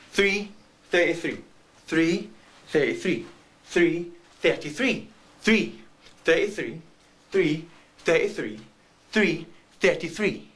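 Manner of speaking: slow, measured see-saw between the two numbers; alternating back and forth. Acted. Man torn between two bus stops, slow alternating repetition. Acts out the endless bus dilemma.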